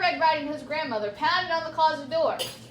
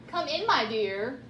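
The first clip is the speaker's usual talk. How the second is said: ordinary delivery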